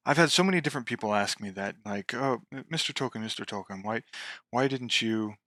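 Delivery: quiet, lightly imitated old English accent; Tolkien's old English accent. Author recalls fans pestering him, soft old English accent. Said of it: "of course in his old English accent".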